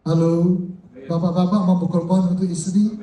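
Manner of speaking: ordinary delivery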